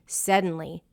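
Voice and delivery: ordinary delivery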